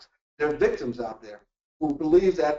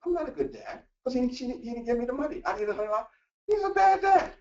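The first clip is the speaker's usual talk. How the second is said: lightly voiced wife, higher and aggrieved; voicing the wife; 8 semitones higher than usual. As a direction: mimic her complaint, rising to high on bad dad. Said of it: "from her point of view".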